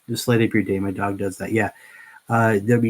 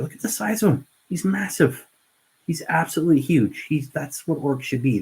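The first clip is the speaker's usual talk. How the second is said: amazed; 6 semitones higher than usual. Real, not acted. Marvelling at the big orc model.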